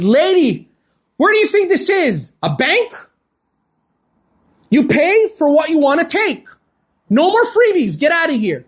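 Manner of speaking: high-pitched, indignant dismissal; retold angry scolding. Market owner refusing a mother credit, angrily scolding. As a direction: act out throwing a customer out.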